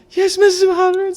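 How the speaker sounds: high, loud eager schoolboy; sweet eager good boy reply. Pretend. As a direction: chirp yes like the teacher's pet. Eager boy answering teacher.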